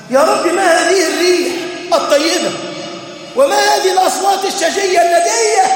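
acted cry of wonder; servant's wondering plea.

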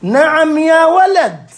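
high, slow, hammed-up reply; mock grave father, slow and high; mock stern old father. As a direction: answer like a grand old sheikh, drawn out. Father answers in mock classical.

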